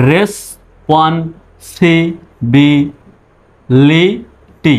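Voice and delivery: stretched out, each syllable drawn long; very slow teaching demo. Shown as a demonstration. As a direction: spell it out slowly for students. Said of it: "এবার দেখুন".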